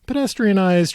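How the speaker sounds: ordinary talk, nothing marked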